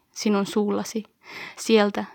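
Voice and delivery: ordinary delivery, nothing marked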